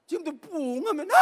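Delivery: high, loud excited squeal with a laugh; mock delighted squeal. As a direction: squeal like a kid at his favorite show.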